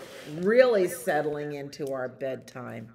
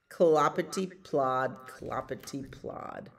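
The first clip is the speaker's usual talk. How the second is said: ordinary delivery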